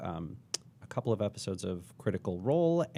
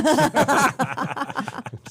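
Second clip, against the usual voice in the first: goblin laugh; 7 dB louder than usual. Retold or imitated.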